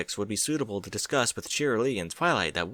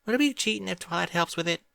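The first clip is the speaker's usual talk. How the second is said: Apple Bloom voice; 9 semitones higher than usual. A narrator's reading. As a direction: pitch it up as an eager young filly.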